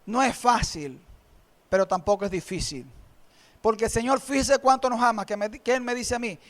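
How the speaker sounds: ordinary talk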